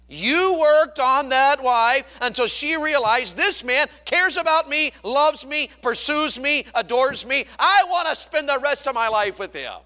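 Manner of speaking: loud, high, driving sermon delivery; full-force preaching. Real, not acted. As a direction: pile up the phrases at a shout.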